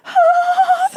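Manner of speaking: loud, high mock gasp; hammed-up shaky gasp. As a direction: exaggerate a trembling breath. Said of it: "like, shaky".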